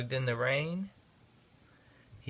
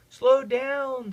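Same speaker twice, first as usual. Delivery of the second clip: loud, higher warning cry; urgent call; 11 semitones higher, 9 dB louder than usual. A narrator's reading. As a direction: shout the warning to slow. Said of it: "said the driver".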